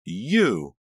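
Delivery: loud, high accusing cry; accusing shout. A narrator's reading. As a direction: hurl it out as an accusation. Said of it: "Karina's hoarse voice bounced off the walls".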